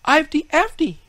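high loud shout of a title; children crying. A narrator's reading. Children shouting for their favourite story, high and loud. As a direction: shout your pick like an eager child. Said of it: "cried some".

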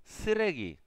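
Sregi with a hard, held double s; tense double s. Shown as a demonstration. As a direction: push the air hard on the s of Sregi. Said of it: "es un poquito más fuerte".

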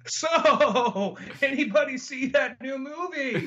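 high, forced-cheery change of subject; awkward small talk. Pretend. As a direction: break the silence with mock awkward cheer.

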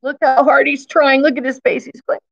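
ordinary delivery, nothing marked

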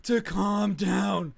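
mock outraged kid. Imitating the emo kid scolding him, slow outraged whine. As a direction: stretch it out like an offended teen. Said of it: "And he's like".

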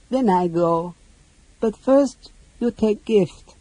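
high, small voice in halting phrases; childlike creature. Acted. Boo-Poo offers the mage a parting gift, childlike. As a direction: pipe up simply, like a little creature.

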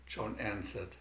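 ordinary delivery

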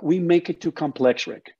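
normal talking voice

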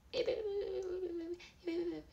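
high, rapid-fire "bebebe" babble; quick high-pitched tongue-flapping noise; fast babbling gibberish. Shown as a demonstration. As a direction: parody fast talking with nonsense syllables. Mock fast-talking gibberish.

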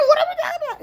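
very high, loud laughing disbelief; incredulous laughter. Real, not acted. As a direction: squeal the question through laughter. Incredulous laughter at absurd comment.

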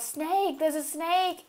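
imitated cry of alarm, held back in volume; retold yell. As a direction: re-enact calling out a warning. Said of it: "yelling out".

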